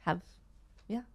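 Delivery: normal talking voice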